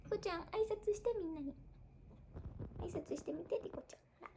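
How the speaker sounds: soft, high coaxing voice; high, quiet pet talk. Real, not acted. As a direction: gently coax a little dog. Coaxing her dog off mic.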